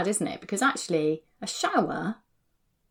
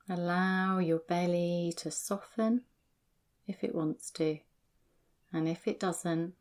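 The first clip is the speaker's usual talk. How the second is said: guided meditation; 4 dB quieter than usual. Acted. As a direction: guide them calmly, one sensation at a time. Guides feet, belly and shoulders.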